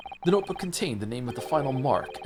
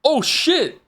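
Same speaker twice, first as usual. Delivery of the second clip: loud, high yelp of shock; startled shout; 14 semitones higher, 8 dB louder than usual. Real, not acted. The player yelps at a twist. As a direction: jump at the surprise.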